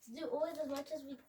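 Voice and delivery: hurried, rattled-off reading; quick run of words at modest volume; too quick and quiet. Real, not acted. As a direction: rush through it without projecting. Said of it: "Louder and slower".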